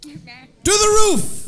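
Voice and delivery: slow, loud villain order; loud, drawn-out command; commanding shout. Acted. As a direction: bark the order at the hostages. Villain orders hostages upstairs.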